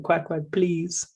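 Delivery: high, slow mock child plea; pleading toddler. Son begs dad for another cracker, pleading toddler voice. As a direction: imitate a toddler begging, high and drawn out. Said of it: "he's like".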